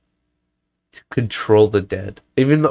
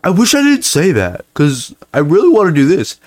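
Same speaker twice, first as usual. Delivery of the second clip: higher mock whine of regret; imitating James; 6 semitones higher, 3 dB louder than usual. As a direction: pitch up, play a regretful friend. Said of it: "he's like".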